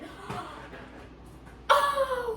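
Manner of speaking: sharp gasp from the cold ache; pained gasp. Real, not acted. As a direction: gasp in sudden pain. Brain freeze hitting.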